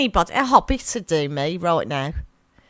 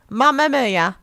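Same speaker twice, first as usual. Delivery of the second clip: loud, higher Brummie demonstration; Brummie accent; 4 semitones higher, 5 dB louder, slower than usual. Shown as a demonstration. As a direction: belt it out in broad Brummie. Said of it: "Say it with a Brummie accent".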